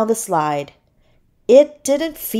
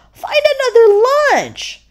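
high, louder playful shooing; 5 dB louder than usual. A narrator's reading. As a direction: shoo little pests away in a raised voice. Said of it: "shooing them away".

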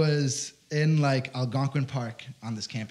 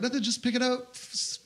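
innocent; 9 semitones higher than usual. Retold or imitated. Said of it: "and I'm like".

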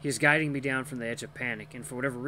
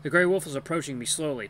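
ordinary delivery

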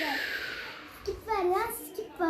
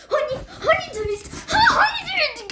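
gloating; 9 semitones higher, 4 dB louder than usual. Real, not acted. Gloating at friends.

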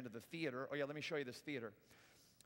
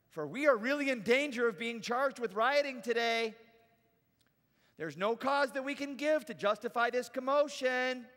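raised, declamatory official voice; town clerk; 8 semitones higher, 9 dB louder than usual. Acted. Town clerk quieting rioters in Ephesus, stern public address. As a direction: address an unruly crowd formally. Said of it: "The town clerk quiets the crowd".

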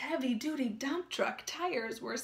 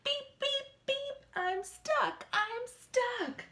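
high, urgent beeps and pleas; stuck truck beeping; 10 semitones higher, slower than usual. A narrator's reading. Stuck little truck beeps high for help. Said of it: "cried Little Blue Truck".

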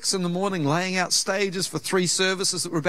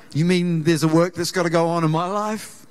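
normal talking voice

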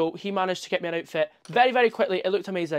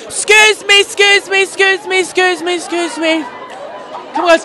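loud high excuse me, fading down each time; repeated loud excuse me easing off; calling through a crowd; 12 semitones higher, 12 dB louder than usual. Real, not acted. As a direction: squeeze past people, calling ahead. Pushing through the crowded queue.

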